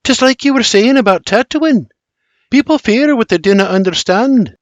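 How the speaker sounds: put-on high, lilting Scottish girl; Scottish Mary, earnest. A narrator's reading. Mary reminds William of his words, earnest Scottish lilt. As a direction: reason with him in earnest, high Scots.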